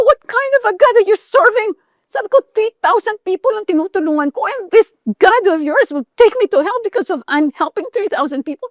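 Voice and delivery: high, heated disbelief, pitched well up; indignant retort. Retold or imitated. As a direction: throw the question back at her, offended.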